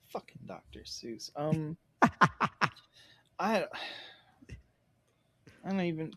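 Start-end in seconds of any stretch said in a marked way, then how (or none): 0.1-1.3 whispered under the breath; whispered curse. Real, not acted. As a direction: mutter it to yourself in a whisper. Muttered curse at a hard question.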